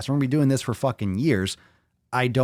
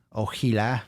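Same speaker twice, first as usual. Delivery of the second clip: put-on old Heli. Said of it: "I miss old Eli sometimes".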